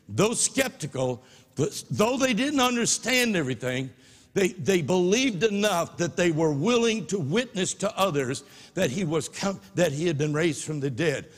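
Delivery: ordinary talk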